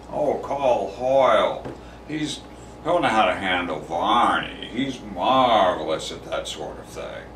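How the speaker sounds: put-on voice of glib praise, loud on the key words; mock admiring colleagues. Colleagues recommending Hoyle to handle Varney, mock admiring. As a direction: imitate colleagues airily recommending their man.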